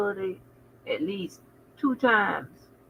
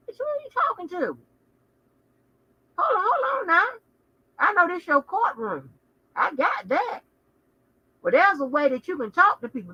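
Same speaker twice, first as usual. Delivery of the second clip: high, fast indignant tell-off; high-pitched sassy reprimand; 8 semitones higher than usual. Retold or imitated. Telling off the judge.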